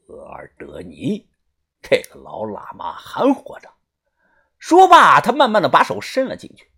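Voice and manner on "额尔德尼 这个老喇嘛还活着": murmuring. A narrator's reading. The villain murmurs over the old lama's basket, low. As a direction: murmur it to yourself. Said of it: "呢喃地说道".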